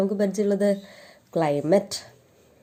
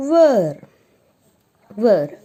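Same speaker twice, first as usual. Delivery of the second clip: emphatic model word; 2 semitones higher, 7 dB louder than usual. Shown as a demonstration. Teacher introducing the question word to pupils, loud and clear. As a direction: call out where clearly. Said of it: "Next one is".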